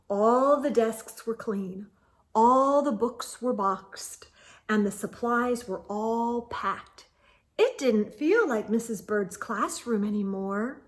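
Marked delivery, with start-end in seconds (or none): none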